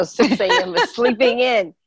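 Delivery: laughing through the words; giggling while saying it. Real, not acted. Laughing at early wake-up joke.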